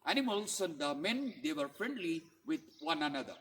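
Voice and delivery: usual speaking voice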